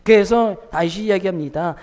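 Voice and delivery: ordinary delivery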